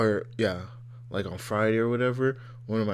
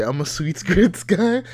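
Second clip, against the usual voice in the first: laughing quote; 6 semitones higher, 7 dB louder, slower than usual. Retold or imitated. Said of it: "I was just about to say".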